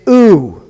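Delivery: "oo" as a long, rounded vowel; oo vowel sound. Shown as a demonstration. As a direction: draw "oo" out slowly, as in Luke. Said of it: "So it's an oo sound".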